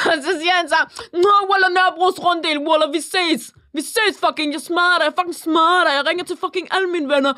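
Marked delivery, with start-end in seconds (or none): 1.2-7.3 hammed-up street-tough threats; imitated provocative boy. Imitating a boy threatening a cashier, provocative. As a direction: rattle off mock threats, pitched up. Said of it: "han var bare sådan fucking provokerende".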